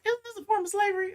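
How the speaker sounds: high, fast mock dawning surprise; mock realization. Telling the listener how they'd react on a close look. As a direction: act out a sudden suspicious realization. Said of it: "you be like".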